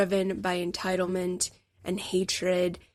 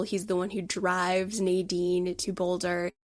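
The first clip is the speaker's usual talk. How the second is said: ordinary delivery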